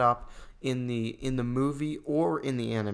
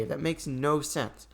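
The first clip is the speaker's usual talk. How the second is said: usual speaking voice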